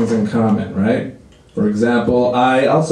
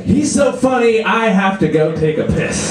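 mock audience member; 7 semitones higher than usual. Imitated audience member mid-show.

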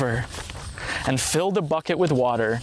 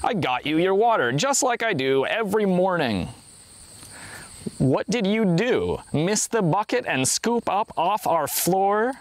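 storyteller voicing a wrongly accused man; indignant retort; 5 semitones higher than usual. A narrator's reading. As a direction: answer back affronted, stretching the words.

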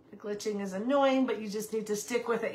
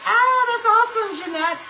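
high, loud burst of excitement; thrilled, much higher exclamation; delighted; 11 semitones higher, 10 dB louder than usual. Real, not acted. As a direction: cheer a friend's good news. Delighted by a viewer's news.

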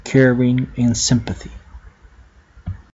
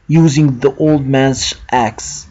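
ordinary delivery

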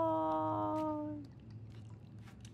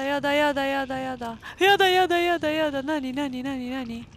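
frightened cries; 6 dB louder, much faster than usual. Real, not acted. Panics at a creature above.

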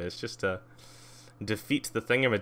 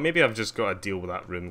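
usual speaking voice

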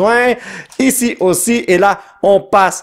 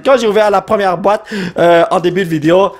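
ordinary delivery

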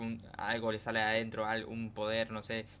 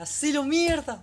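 very high, very loud drawn-out scream; screaming cheer; 9 dB louder, slower than usual. Real, not acted. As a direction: scream it at the screen in full hype. Cheering on anime hero.

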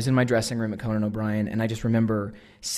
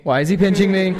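louder, higher mock panic; freaking out; 6 semitones higher, 5 dB louder than usual. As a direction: repeat it in rising hammed-up alarm. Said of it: "I freak out".

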